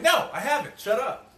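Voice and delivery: high, loud, playfully defensive; mock annoyed friend. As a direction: snap back like a spoiler-dodging friend.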